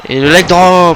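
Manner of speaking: loud, high excited call of the move. Real, not acted. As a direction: shout the move name like a wrestling commentator. Commentator calling a big move.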